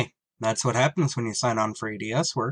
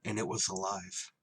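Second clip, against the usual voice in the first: story narration; 2 semitones lower, 6 dB quieter than usual. A narrator's reading. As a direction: tell it like a tense memory. Pilot narrates the discovery.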